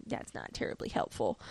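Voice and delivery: low, almost whispered reply; muttered aside. Retold or imitated. Coulson answering Robin's unhelpful remark, muttered aside. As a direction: drop it under the breath. Acted dry reply to her.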